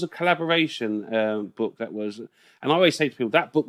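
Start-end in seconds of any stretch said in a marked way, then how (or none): none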